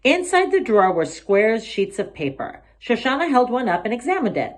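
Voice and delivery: ordinary delivery, nothing marked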